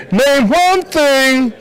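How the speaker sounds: high, slow Steve Harvey voice; drawn-out game show host voice; Steve Harvey impression. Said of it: "That was my Steve Harvey".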